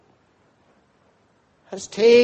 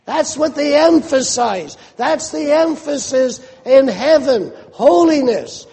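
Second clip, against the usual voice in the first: usual speaking voice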